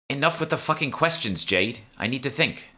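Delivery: high, sharp exasperated outburst; angry snap. A narrator's reading. Emma stuck and snapping at Jade to stop asking. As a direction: snap at her to shut up.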